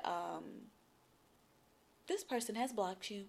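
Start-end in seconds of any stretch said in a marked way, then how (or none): 2.1-3.2 flat automated message. Retold or imitated. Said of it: "texted back and said".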